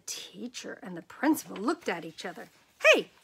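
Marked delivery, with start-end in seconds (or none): 2.8-3.1 high, loud outraged order; indignant demand. Acted. Principal demanding his suit back, outraged. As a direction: snap it like a scandalised boss.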